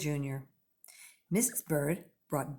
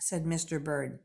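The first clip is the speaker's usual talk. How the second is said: ordinary delivery, nothing marked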